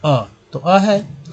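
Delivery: अ drawn out slowly; modelled vowel. Shown as a demonstration. Teacher answers her own question with a slow अ. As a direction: hold अ long and clear. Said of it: "यानी कंपनी में जो ए है उसको क्या पढ़ते हैं".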